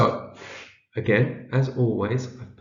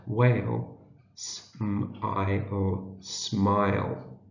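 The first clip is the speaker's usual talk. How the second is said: very slow, word by word with gaps; sounding out; 2 semitones lower, much slower than usual. Shown as a demonstration. Teacher sounds out a letter for early readers, slow decoding. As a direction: break words into sounds, then blend them. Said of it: "see if we can read it".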